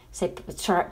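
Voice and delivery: ordinary talk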